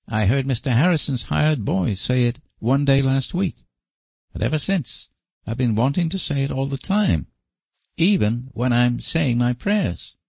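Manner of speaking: put-on high, earnest small boy; little boy voice. A narrator's reading. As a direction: explain like a guilty child. Davy explains.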